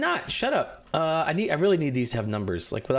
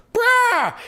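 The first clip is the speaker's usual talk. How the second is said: excited shout; 8 dB louder than usual. Acted. DM hypes the creature landing.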